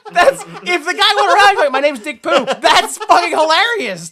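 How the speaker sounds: loud, high, laughing through the words; can't stop laughing; laughing hard. Real, not acted. Cracking up at the joke.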